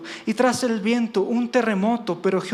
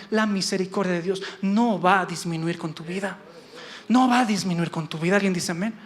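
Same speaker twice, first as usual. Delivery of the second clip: ordinary talk, nothing marked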